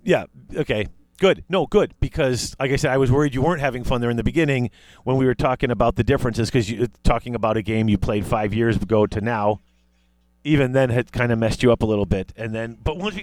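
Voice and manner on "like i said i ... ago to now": ordinary delivery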